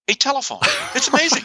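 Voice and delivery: high-pitched feigned wonder; mock amazement. Host reveals smartphones can make calls, in mock amazement. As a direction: marvel sarcastically at the obvious.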